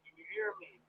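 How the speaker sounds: faint caller off the mic; distant phone voice; phone held away. Real, not acted. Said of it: "you gotta talk into it".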